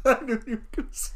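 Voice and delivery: quick words tumbling out in laughter; laughing. Real, not acted. Laughing at the co-host's predictable take, amused. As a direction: crack up, then talk through it.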